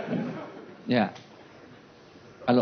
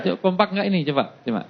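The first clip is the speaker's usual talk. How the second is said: ordinary talk, nothing marked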